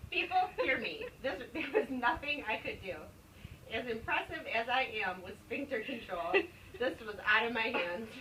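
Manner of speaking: usual speaking voice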